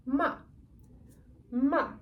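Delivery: "ma" dropping sharply from high; falling fourth tone. Shown as a demonstration. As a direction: snap "ma" down from the top. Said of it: "is descending tone only".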